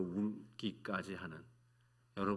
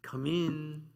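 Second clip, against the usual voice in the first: professor answering door; 6 semitones higher than usual. Retold or imitated. Professor answers knock in story.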